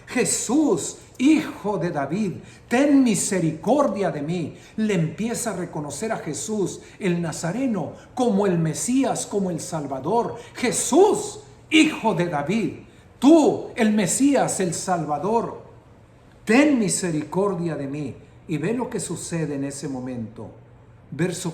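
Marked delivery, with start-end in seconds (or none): 0.1-4.6 loud desperate cry to Jesus. Retold or imitated. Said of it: "Comenzó a gritar". 10.5-18.1 louder cry out. Retold or imitated. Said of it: "Comenzó a gritar".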